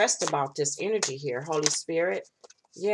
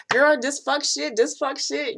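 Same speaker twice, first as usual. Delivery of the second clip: higher, sassy fed-up client voice; imitating clients; 6 semitones higher than usual. Reader imitates clients complaining about their exes. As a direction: mimic a fed-up girlfriend griping. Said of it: "every one of y'all talk to me said".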